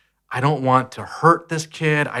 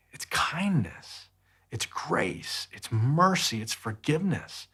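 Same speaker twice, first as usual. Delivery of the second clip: breathy whisper, much softer; 2 semitones higher, 9 dB quieter, slower than usual. Real, not acted. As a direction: drop to a hushed whisper on each word. Quiet list of virtues.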